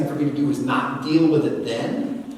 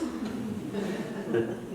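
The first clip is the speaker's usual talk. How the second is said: soft laugh; 6 semitones higher, 10 dB quieter than usual. Real, not acted. Laughs at kids' good ideas.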